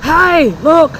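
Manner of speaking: high mock yell from a passing car; loud high mock heckle; guy yelling from car. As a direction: shout it out like a rude driver. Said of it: "this guy yells out".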